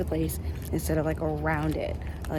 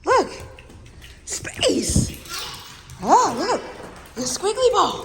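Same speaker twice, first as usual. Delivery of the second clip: very high, louder gushing to the dogs; excited dog talk; 11 semitones higher, 3 dB louder, slower than usual. Real, not acted. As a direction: squeal it with delight for the dogs.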